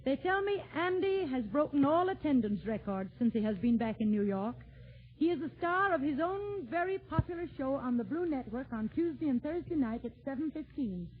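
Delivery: normal talking voice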